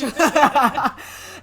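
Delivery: loud, high burst of laughter; big laugh. Real, not acted. Reader amused by the couch euphemism, laughing loudly. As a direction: crack up at your own line.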